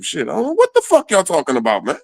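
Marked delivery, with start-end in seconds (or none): none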